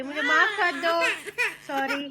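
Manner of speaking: sorry said through a laugh; laughing apology. Real, not acted. Mother laughs as she begs her baby's forgiveness, laughing softly.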